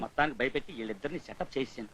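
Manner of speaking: very low, hushed self-talk; secret aside. Acted. Schemer privately noting the scare worked, hushed. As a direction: drop right down, as if to yourself.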